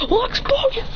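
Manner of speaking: very high, strained comeback; acted threat.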